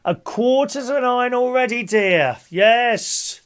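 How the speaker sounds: loud, sing-song number calling; bingo caller. Acted. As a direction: call the numbers to the hall. Calls bingo numbers to players.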